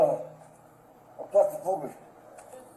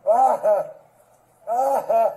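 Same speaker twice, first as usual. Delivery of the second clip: pained groans; 6 dB louder than usual. Real, not acted. Groaning in pain on ground.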